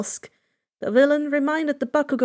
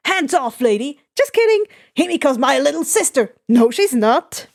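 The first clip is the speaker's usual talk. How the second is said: erratic Twice; 5 dB louder than usual. Acted. Twice grabs Himiko, contradicting himself.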